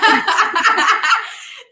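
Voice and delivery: loud amused laugh; loud high laugh. Real, not acted. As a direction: burst of real high laughter. Reacting to joke.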